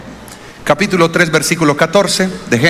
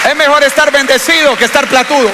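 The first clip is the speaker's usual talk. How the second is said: preaching punchline; 9 semitones higher than usual. Real, not acted. Sermon punchline.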